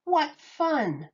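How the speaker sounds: usual speaking voice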